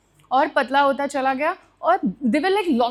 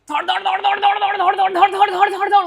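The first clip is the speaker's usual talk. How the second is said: rapid rattling noise, higher and louder; imitated racing rattle; 7 semitones higher, 4 dB louder, much faster than usual. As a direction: mimic the car's banging as it speeds, pitched up. Imitating car racing fast.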